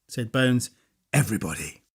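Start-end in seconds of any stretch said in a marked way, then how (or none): 1.1-1.8 much lower and softer, dreamy; quiet repeat. A narrator's reading. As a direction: murmur it to yourself softly.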